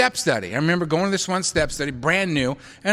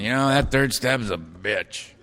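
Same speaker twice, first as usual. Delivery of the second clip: low, muttered bar-stool complaint; barroom grumble; 6 semitones lower, 3 dB quieter than usual. Retold or imitated. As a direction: grumble it like a guy nursing a drink. Guys in bars grumbling.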